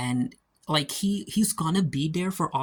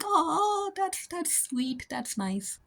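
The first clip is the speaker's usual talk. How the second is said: high, slow, softening into a gush; gushing aww; 4 dB quieter, much slower than usual. Retold or imitated. Viewer reacting to Larry honoring Emily, melting coo. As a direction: coo it like you're touched. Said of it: "I'm like".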